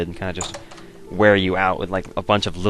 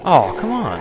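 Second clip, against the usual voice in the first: exasperated groan; 8 semitones higher, 6 dB louder, slower than usual. Real, not acted. Frustrated at game.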